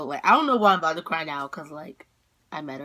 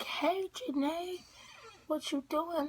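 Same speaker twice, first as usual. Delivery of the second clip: high, soft, drawn-out singsong greeting; imitated best friend; 11 semitones higher, 9 dB quieter, slower than usual. Best friend at the door, high singsong hello. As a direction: mimic a friend in a small high voice.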